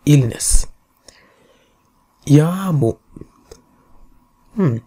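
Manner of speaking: ordinary talk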